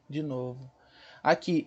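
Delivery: usual speaking voice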